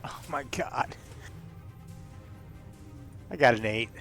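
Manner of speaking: ordinary delivery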